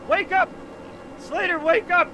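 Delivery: loud urgent yell; shouting wake up. Pretend. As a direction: yell him awake, rising. Yelling at passed-out pilot.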